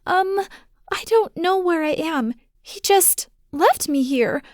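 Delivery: put-on high, small child's voice, lost; a scared child who doesn't know where she is; frightened little girl. Daughter tells her father on the phone, high and scared.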